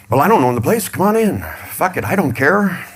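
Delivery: a flustered bouncer waving him through; caving doorman. Retold or imitated. Acted doorman giving in.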